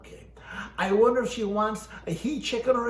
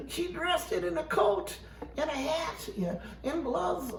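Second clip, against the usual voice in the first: high disbelief; 8 semitones higher, 4 dB quieter than usual. Acted. Continues high-pitched disbelief.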